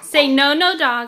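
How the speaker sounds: loud, firm command to the dog. Real, not acted. Parent loudly scolding the family dog, firm command.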